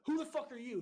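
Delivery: high, loud challenge; retold angry demand. Recruit leader to his brother recruit, loud angry demand. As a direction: re-enact demanding an answer with rising anger. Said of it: "And I just kept getting angry".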